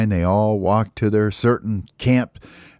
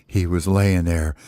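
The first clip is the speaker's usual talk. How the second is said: ordinary delivery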